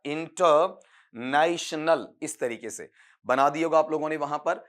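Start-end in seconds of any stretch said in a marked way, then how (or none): 0.1-2.1 इंटरनेशनल very slowly, broken after इंटर; slow pronunciation demo. Shown as a demonstration. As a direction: draw out इंटरनेशनल part by part for learners. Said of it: "ये फिर बोल रहा हूं तीसरी बार".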